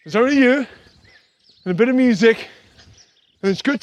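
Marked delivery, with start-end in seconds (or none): none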